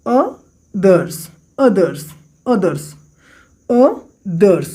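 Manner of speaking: "others" sounded out slowly as two beats, uh and thers; teacher demonstrating the two sounds in "others"; syllable-by-syllable demo. Shown as a demonstration. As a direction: pronounce "others" deliberately, parting uh from thers. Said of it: "जब इसको आप प्रोनाउंसिएट करिएगा तो इसमें क्या sound निकल".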